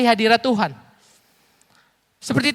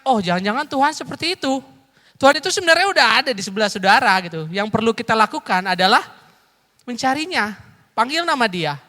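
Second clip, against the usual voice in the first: normal talking voice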